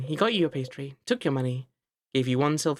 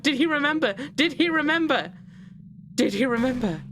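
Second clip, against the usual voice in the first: building urgency; 8 semitones higher than usual. A narrator's reading. Narrator spiralling through questions, building to a cry. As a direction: let the questions climb and press harder.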